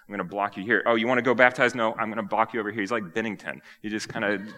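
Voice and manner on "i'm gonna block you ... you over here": mock goalie blocking. John playfully blocking Jesus.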